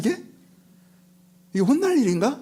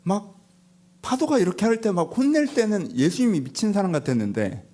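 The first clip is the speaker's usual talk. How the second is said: ordinary talk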